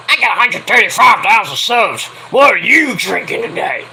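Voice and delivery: put-on boastful streamer voice; mock him with an exaggerated put-on voice; mocking rival impression. Mimics rival bragging to his subscribers.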